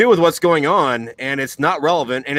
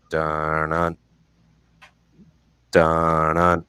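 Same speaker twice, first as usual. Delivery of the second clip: low, heavy "dun-dun" booms; slow, ominous low thud imitation; imitated thuds; 10 semitones lower, much slower than usual. Mimicking a giant animal's footsteps by the car, ominous. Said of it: "the sound".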